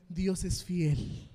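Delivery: slow, drawn-out proclamation; slow declaration. Real, not acted. Preacher assuring the church, slow and drawn out. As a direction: let each word land slowly.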